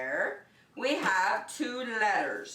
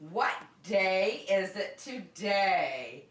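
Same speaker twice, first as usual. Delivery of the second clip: ordinary delivery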